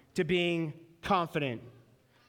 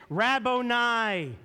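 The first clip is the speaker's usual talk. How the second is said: drawn-out joyful cry; 4 semitones higher, 5 dB louder, much slower than usual. A narrator's reading. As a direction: call the name out slow and strong. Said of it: "cried out in Aramaic".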